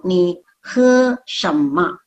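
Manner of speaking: Ni he shema very slowly, long "ma"; correct Mandarin shema. Shown as a demonstration. Teacher repeats the correct form very slowly for students. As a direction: model it at half speed. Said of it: "Itu bukan seperti itu".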